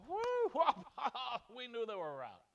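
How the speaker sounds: high, loud jeering cackle at Israel's fall; mocking gleeful laugh. Gentiles mocking Israel's captivity, high loud jeering laugh. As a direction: gloat like a crowd laughing at a rival. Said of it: "they go".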